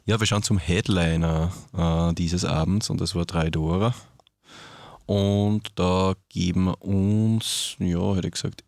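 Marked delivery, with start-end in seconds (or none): none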